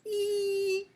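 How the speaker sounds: I held long on one high pitch; long, level I; sustained vowel sound. Shown as a demonstration. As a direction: draw out I as a high, steady "eee". Teacher models the target sound.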